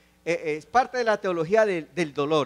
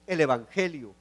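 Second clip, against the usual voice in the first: ordinary talk, nothing marked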